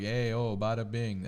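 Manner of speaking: hammed-up Italian mobster accent; playful Italian accent, bada bing style. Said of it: "when I'm describing, like, me being a kid".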